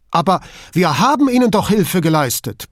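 indignant, pushed-up objection; protesting. A narrator's reading. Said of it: "protestierte Duso".

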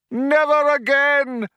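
high, loud, slow rally cry; cheerleader. A narrator's reading. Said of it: "cried the man".

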